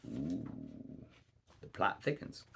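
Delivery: hushed, conspiratorial ooh; a storyteller whispering a teasing aside to the audience; whispered aside. Real, not acted. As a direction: lean in and whisper to the listener. Narrator's aside to listener.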